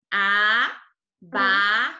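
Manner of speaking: abacaxi drawn out as a... ba... ca... xi; four held, separate syllables in abacaxi. Shown as a demonstration. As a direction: count out a-ba-ca-xi for the children. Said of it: "Quatro vezes a tia abriu a boca".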